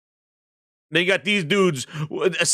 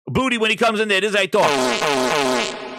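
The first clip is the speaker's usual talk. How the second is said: usual speaking voice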